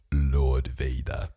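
deep, slow, ominous master summoning his servant; the Emperor. Acted. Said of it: "then the Emperor, all you hear is his voice".

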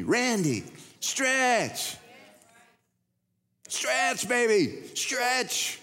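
high, drawn-out loving command; God calling him to stretch. Retold or imitated. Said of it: "that's God's answer to me".

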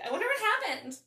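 high, laughing wonder; mock innocent musing. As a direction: play innocent while laughing. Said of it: "I was like".

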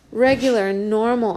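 put-on girl blurting out through tears; crying out. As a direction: let it burst out of her. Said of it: "I cry".

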